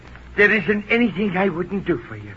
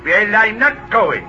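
slow, higher-pitched, emphatic; drawn-out angry refusal; defiant refusal; 4 semitones higher, 4 dB louder, slower than usual. Acted. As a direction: dig your heels in stubbornly. Jippo refuses the summons.

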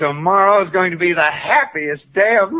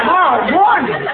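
high, startled echo; shocked disbelief; 12 semitones higher than usual. Acted. Husband shocked it is tomorrow morning, astonished. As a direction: blurt it back in astonishment.

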